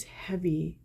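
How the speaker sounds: ordinary talk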